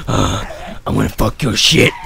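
a low growled voice, slow; voicing the creature. Gamer voicing what the breathing villager thinks, low and slow. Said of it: "he's like".

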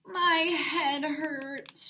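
a slow, drawn-out moan. A narrator's reading. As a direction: whimper it out as if feeling sick. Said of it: "she moaned".

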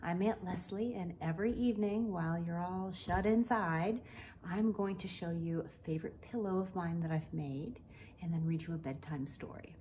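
ordinary delivery, nothing marked

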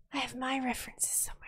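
unvoiced whisper to herself. Real, not acted. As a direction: hush it while searching. Whispering while searching.

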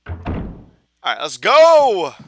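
loud, slow rallying call; loud slow hype before the fight; psyched up. Real, not acted. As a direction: pump yourself up. Psyching up for boss.

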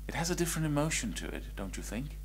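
soft and quick; hushed aside; quiet. Shown as a demonstration. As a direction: murmur it lightly. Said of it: "when I speak like this".

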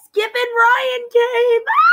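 high, loud, drawn-out toy announcement; excited mock fanfare; playful shout. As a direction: call it out high, ending in a squeal. Playful outburst showing off toys.

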